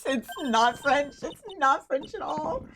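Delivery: high, squeaky words through giggles; cracking up at your own bad French accent; real embarrassed laughing protest; helpless laughter. Real, not acted.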